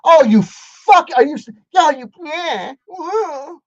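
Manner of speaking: high, loud delighted whooping; excited whoop. Real, not acted. Host heckling Peterson's clip, loud high whoops.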